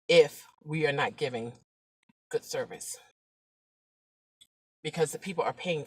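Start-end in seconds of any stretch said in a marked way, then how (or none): none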